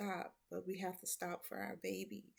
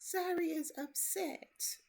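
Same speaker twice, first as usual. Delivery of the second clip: put-on high whispered little-girl voice; slow whispered child's complaint; upset child whisper; 9 semitones higher, slower than usual. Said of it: "she says".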